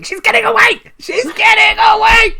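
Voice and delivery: high, loud shriek; shrieking in alarm at an escape; screaming. Acted. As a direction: scream it at the top of your voice, very high. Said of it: "Luffy is just screaming".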